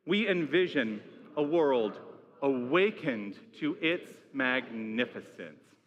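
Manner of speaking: slow, measured vision statement; group recitation. Real, not acted. Minister leading the congregation in the vision statement, slow recitation. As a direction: recite it slowly, phrase by phrase, so all can join. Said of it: "Let's say this together".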